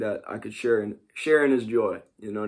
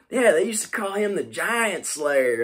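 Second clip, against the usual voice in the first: imitating a good old boy from Texas in a Southern accent; 6 semitones higher than usual. Retelling what his Texan friend said, in a mild Southern accent. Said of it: "he says in his Southern accent".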